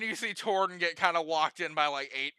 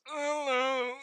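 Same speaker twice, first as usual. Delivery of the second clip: lightly British, pompous greeting; a hint of a stuffy British judge; 8 semitones higher than usual. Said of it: "I don't know why I'm making him British".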